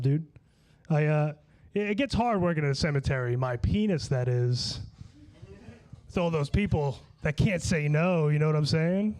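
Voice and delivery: usual speaking voice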